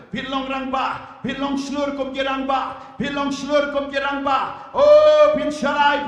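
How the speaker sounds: shouted preaching; shouting. Real, not acted. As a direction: shout the prayer out.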